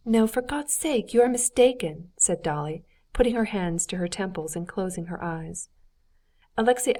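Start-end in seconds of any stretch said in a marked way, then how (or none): 0.1-2.1 pleading protest. A narrator's reading. Dolly defending her friend to the husband, pleading protest. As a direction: insist he must be wrong, imploringly.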